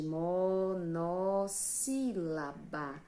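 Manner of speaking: "monossílaba" stretched slowly, "mo" and "no" held long; teacher emphasizing the new term; slow teaching term. Shown as a demonstration. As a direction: draw out "monossílaba" beat by beat. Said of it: "Mono quer dizer uma".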